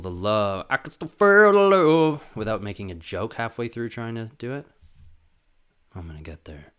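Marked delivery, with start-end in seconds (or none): 5.9-6.7 low whispered to self. Real, not acted. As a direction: breathe it out in a whisper.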